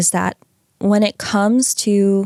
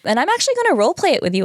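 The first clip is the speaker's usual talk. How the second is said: excited; 7 semitones higher, much faster than usual. Real, not acted. Announces the role play eagerly.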